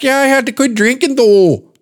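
high female impression in thick Minnesota accent. Lady explaining she quit drinking, high mock Minnesota accent. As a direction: mimic her Minnesota accent, high, stretching "though". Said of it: "She's like".

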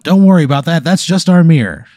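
high, loud, over-cheery announcer; TV promo host. Acted. Mirror screen lights up to greet a diner, chirpy announcer. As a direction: belt it out like a screen advert. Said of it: "a little T V screen on the mirror lights up".

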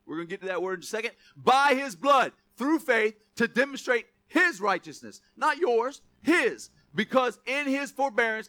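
high, loud, building sermon reading; full-force preaching. Real, not acted. Pastor reading Romans to the church, loud and high. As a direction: preach it out with fire.

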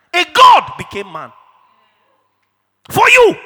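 sudden full-force shout, leaping high on the last words; impassioned preaching shout. Real, not acted. Preacher confronting men with God's sacrifice, impassioned shout. Preacher exclaims against mocked boast.

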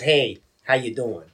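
slow, low-pitched "Hey, how you doing?"; low, slow, downbeat retelling of a greeting; someone else's misreading. Shown as a demonstration. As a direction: drop "Hey" low and drag it out. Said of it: "telling somebody else it was".

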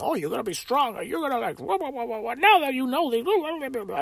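high, soft babbling imitation of a dad; mock lip service. Superficial dad hands his upset son empty encouragement, mocking imitation. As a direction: parody an empty pep talk, sliding into high blather. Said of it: "he was just giving him lip service about some bullshit".

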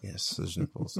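whispered. Acted. Hushed reply to whisper.

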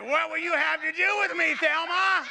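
high, loud hammed-up demon taunt; mock demon voice. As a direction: tease her as the demon. Son plays demon to mother.

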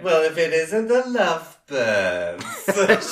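slow, high, sneering bully voice; mock sneering bully. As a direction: drag it out with a sneer. Mocking quote of bully Judy.

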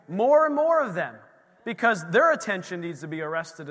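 loud, fervent sermon voice; preaching. Acted. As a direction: preach the miracle to the room. Testimony preached with force.

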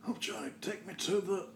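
faint whisper. Acted. Injured plea.

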